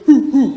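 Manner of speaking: loud owl hoot. Shown as a demonstration. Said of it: "You gotta go".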